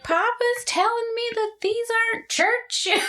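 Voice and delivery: mock kid whine; crying kid. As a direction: whine it like an upset child. Child crying retold.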